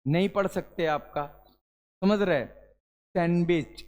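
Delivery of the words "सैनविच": सैंडविच drawn out slowly, 'san-bich'; silent D. Shown as a demonstration. Teacher modelling it for pupils, सैंडविच as slow 'san-wich'. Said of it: "यानी डी हमने बोला था इसका".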